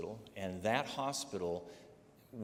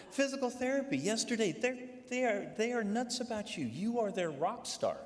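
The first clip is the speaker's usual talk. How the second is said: much higher, eager nurse gushing the news; 12 semitones higher than usual. Retold or imitated. Nurse gushes to the patient about physical therapy, high and excited. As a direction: bubble over like a starstruck nurse.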